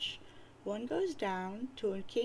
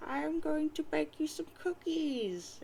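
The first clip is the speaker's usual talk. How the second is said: sweet nana; 6 semitones higher than usual. Acted. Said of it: "And she says".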